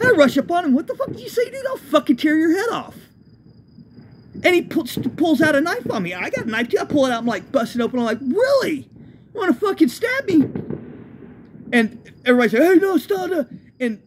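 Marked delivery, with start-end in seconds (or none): 0.7-3.0 very high enraged yell; furious threat. Retold or imitated. Narrator charging the man who insulted him, furious yell. Rushing up on the guy. 8.2-10.5 very high enraged taunt; furious taunt. Retold or imitated. Facing a man with a knife, enraged mocking taunt. 12.6-13.5 high, louder calls to stop; shouted pleas. Retold or imitated. Bystanders pleading to stop a knife fight, shouting.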